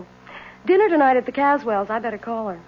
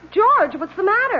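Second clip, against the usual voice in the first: sudden alarm; 10 semitones higher, 4 dB louder than usual. Acted. Wife sees him freeze mid-game.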